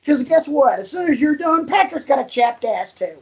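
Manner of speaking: high-pitched silly segment billing; bill it high and playful, like a gag title; mock announcement. Podcast host teasing co-host about his turn, high mock announcement.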